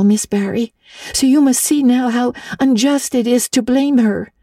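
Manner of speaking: put-on high, earnest girl's voice pleading a case; an orphan girl defending her friend; eager little girl Anne. A narrator's reading. Anne pleads with Miss Barry for Diana, high and earnest.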